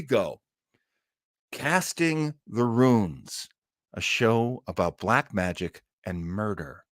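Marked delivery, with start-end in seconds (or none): 1.5-6.8 slow, drawn-out spooky show intro; dramatic host intro, trailing off into a whisper; show announcer. Acted. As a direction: announce it like an old radio host.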